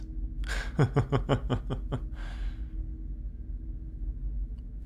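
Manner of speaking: quiet breathy laugh; amused chuckle. Acted. Captor admiring captive's rare looks, amused chuckle. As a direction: chuckle softly, intrigued.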